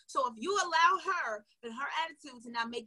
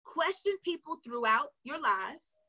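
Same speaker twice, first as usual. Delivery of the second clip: ordinary talk, nothing marked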